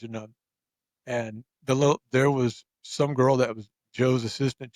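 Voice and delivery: ordinary talk, nothing marked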